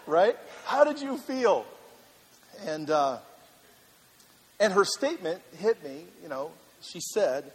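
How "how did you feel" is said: retold eager question. Retold question to wife, repeated.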